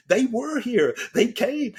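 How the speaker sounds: high, amazed disbelief; awestruck realization. Retold or imitated. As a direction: say it as if seeing proof. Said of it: "I said".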